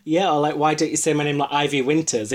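normal talking voice

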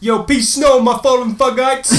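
high, loud hip-hop shoutout; rapper voice. Pretend. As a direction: hype it up like a rapper.

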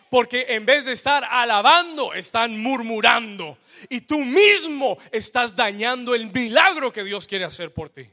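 loud, rising preacher's cry; full-force preaching. Acted. Preacher to his congregation, at full force. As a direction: preach it out to the whole church.

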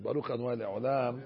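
low, soft prayerful chanting; slowing at the end, a quiet blessing; prayer recitation. Acted. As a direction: recite it gently like a closing prayer.

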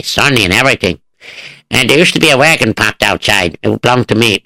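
ordinary delivery

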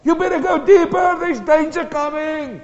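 high, full-force acted shout; shouted warning. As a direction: yell a danger warning down a hole. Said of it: "he stood there and he shouted".

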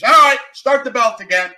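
loud, high-pitched foreman's shout; calling out. Acted. Worker ordering heaven's assembly line restarted, loud shout.

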